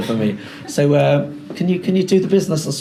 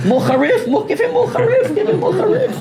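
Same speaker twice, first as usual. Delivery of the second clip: much higher, laughing demand for more spice; laughing excited call. Pretend. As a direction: egg him on with a laughing shout. Teasing student about spicy food.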